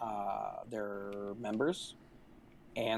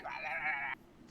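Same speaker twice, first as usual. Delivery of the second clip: mock angry rant; 4 semitones higher, 3 dB quieter than usual. Mocks the gym man's rant again.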